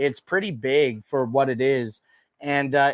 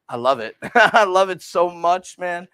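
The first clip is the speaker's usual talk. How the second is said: gushing with laughter; 5 semitones higher, 5 dB louder than usual. Real, not acted. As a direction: laugh and gush loudly about what you love. Gushing over favorite product, laughing.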